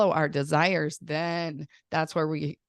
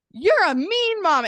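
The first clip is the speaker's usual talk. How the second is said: put-on high, loud, slow whiny kid voice; kid's complaint; 12 semitones higher, 7 dB louder, slower than usual. Mother imitating her son accusing her, high and whiny. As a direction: mimic the kid sulking it out. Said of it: "my kid was like".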